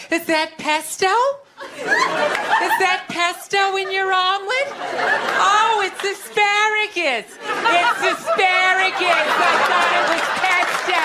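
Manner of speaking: mock a friend marveling over eggs; vapid brunch friend. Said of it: "you know, like".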